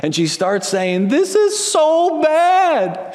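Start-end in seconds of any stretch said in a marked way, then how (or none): 1.1-3.1 put-on high, slow, drawn-out child's whine; whiny child. Daughter whining to herself in the other room, high and drawn out. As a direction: whine it like a four-year-old over a broken toy. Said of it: "that just whiny cry".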